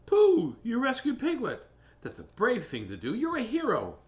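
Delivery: put-on high, bright-eyed boy praising his friend; a high child voice full of praise; Christopher Robin voice.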